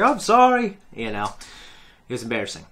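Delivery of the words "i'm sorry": sheepish apology. Retold or imitated. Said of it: "I think".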